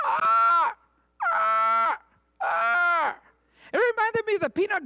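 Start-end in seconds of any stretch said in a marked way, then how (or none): 0.1-3.2 high loud shrieking cries; what the disciples hear: loud high squawks; noise like a raven. Retold or imitated. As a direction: screech like a bird. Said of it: "all they hear is like".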